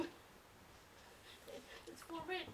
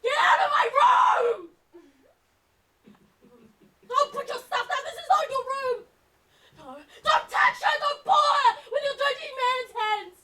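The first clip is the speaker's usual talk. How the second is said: high, loud, outraged yell; distraught, possessive screaming; furious shouting; 10 semitones higher, 7 dB louder than usual. Acted. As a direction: order the intruders out at full voice. Yelling at intruders in own room.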